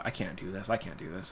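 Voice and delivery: low, quiet defeated self-quote; depressed. Retold or imitated. Teenager at first doubting himself, retold as depressed. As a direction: say it softly, as if giving up. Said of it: "I was, like, depressed and stuff".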